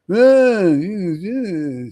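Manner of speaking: loud, high mocking cry of disdain; scornful mock outburst. Mocking the blind critics with a loud, scornful cry. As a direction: sneer it out like a know-it-all. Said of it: "and they think".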